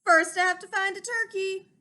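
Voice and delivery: big loud bellow back up the hill; Mister Moose bellowing back to Sheep. Acted. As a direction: shout it back across the slope. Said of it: "bellowed back".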